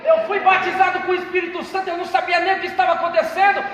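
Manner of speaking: loud, ringing pulpit testimony with music; preaching at full force. Acted. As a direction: preach it out to the whole church. (pregação inflamada em voz alta)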